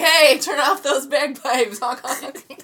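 loud, slow mock shout; mock yell. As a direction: yell at the bagpiper. Voicing a driver yelling.

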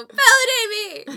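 high loud wailing plea; whiny wail. Retold or imitated. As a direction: wail it like a needy child. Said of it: "I just go".

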